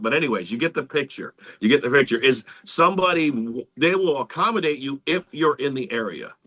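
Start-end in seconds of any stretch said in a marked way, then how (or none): none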